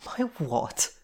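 disbelieving question, landing hard on the last word; mild disbelief; incredulous. A narrator's reading. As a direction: ask as if you misheard.

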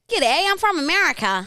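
high-pitched put-on American-Australian accent; mock an Aussie back with an American accent; gap-year fake American accent. Gap-year traveller home from overseas, put-on American accent. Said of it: "they come back like".

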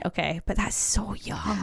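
hushed whispered aside. Real, not acted. Reacting to marriage at sixteen, whispered aside. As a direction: breathe it out as a secret aside.

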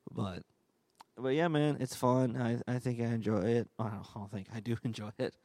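normal talking voice

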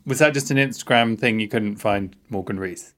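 ordinary talk

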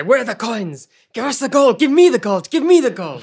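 high, loud interrogation; frantic, insistent acted yelling; shouted demand. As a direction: shout as if shaking it out of them. Acted shakedown of the bear.

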